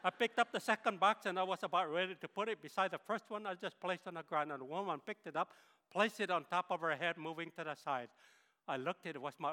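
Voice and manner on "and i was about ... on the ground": ordinary delivery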